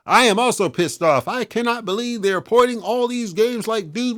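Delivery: high-pitched mock of a pissed-off gamer; mocking whiny fan. Reading another fan's angry comment aloud in a high, mocking voice. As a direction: sneer it out as a silly fan.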